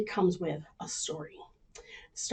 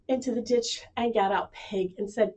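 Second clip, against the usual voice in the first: ordinary delivery, nothing marked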